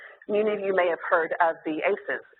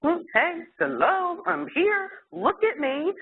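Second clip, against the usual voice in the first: put-on higher and higher whiny baby calls; fussing baby; 9 semitones higher, slower than usual. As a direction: climb up in pitch like an ignored infant. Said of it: "they would start to kind of fuss".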